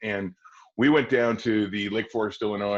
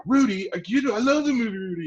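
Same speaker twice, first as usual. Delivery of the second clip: squealing Rudy fan, much higher and louder; excited fan; 13 semitones higher, 5 dB louder than usual. Retold or imitated. Boy tells the casting scout he loves Rudy, squealing excitedly. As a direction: gush about the movie Rudy. Teller's excited retold reaction.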